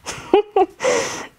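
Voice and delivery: high, excited little laugh; giddy high-pitched giggle; delighted giggle. Acted. Delighted at the elopement note.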